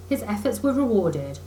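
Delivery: plain reading voice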